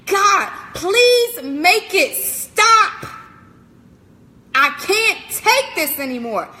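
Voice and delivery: desperate mock-scream at night; retold scream to God. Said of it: "I was literally screaming".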